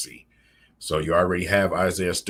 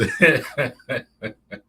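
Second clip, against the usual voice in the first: laugh; 6 semitones higher, 5 dB louder than usual. Real, not acted. Laughing at own voice.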